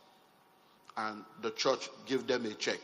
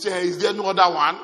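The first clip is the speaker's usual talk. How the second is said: louder, eager demand for more; clamouring buyers; 4 semitones higher, 10 dB louder than usual. Retold or imitated. Buyers demanding more houses, louder eager demand. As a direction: push like a buyer who missed out.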